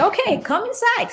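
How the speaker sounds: high-pitched, put-on invitation; mock-welcoming. New homeowner letting the neighbor in, high put-on welcome. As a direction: pitch it way up, playing the host.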